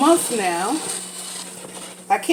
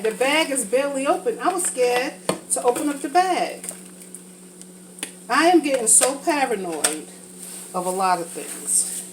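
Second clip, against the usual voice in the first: normal talking voice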